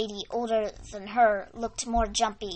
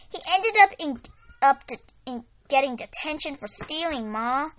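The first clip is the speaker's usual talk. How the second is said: excited; 4 semitones higher, 5 dB louder than usual. Acted. Daughter reporting to parents.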